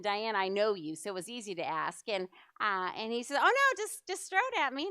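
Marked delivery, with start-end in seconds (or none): 3.4-4.9 imitating a carefree guy, much higher and louder; breezy guy voice. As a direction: toss it off like nothing scares him. Quoting Dwayne's breezy answer.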